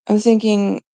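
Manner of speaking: ordinary talk, nothing marked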